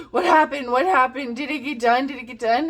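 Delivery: mock panic on waking; panicked questions. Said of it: "I'm just like".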